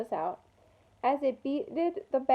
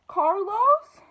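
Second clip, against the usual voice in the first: alarmed call; 7 semitones higher, 6 dB louder than usual. A narrator's reading. Ralphie gasps in alarm.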